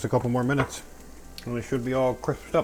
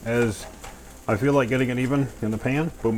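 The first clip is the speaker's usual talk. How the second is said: ordinary talk, nothing marked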